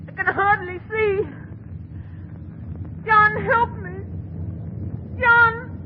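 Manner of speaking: high, rising cry for help; terrified plea. Acted. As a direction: plead in mounting panic. Wife in terror begs husband.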